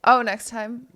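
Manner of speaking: usual speaking voice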